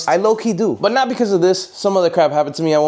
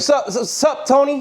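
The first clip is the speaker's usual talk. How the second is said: casual repeated sup, pitched up; lighter, higher voice for Carlos; 8 semitones higher, slower than usual. Retold or imitated. Carlos greets Tony from below, mock casual. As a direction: toss it off like a quick hello. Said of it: "Or maybe more like".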